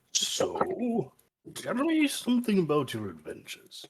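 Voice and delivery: softly probing, with a lifted, lilting tune; quiet, leading curiosity; character voice. Acted. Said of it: "turn to Garg and be like".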